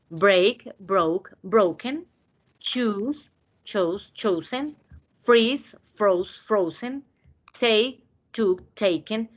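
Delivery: each verb form said slowly and apart for the class; slow drill. Shown as a demonstration. Teacher drilling verb forms for students, slow and clear. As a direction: spell out every form, slowly. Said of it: "Repetirlos así".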